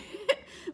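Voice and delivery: high burst of laughter; happy laugh. Real, not acted. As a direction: laugh with joy. Joyful laugh while telling.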